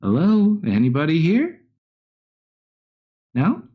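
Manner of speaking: high, lilting call into an empty space; searching bandit. Acted. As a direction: call out to see if anyone is there. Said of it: "you hear one kind of start speaking".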